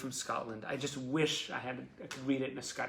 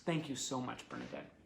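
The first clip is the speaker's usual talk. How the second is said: usual speaking voice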